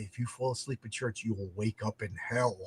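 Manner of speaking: low, hushed mock threat; ominous mother. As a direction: imitate a mother quietly warning of damnation. Imagines his mother's warning.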